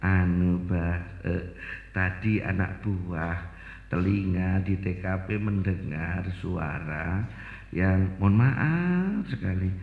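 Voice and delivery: low, soft, slow and hesitant; a nervous subordinate bowing before the old cleric; timid report to elder. Acted. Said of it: "Nganu, Mbah". (melapor pelan dan rendah dengan takut-takut)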